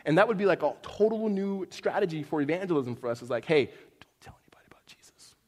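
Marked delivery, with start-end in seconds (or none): 3.5-5.3 hushed, conspiratorial whisper; comic stage whisper; mock secretive whisper. Mock secret strategy for evangelism.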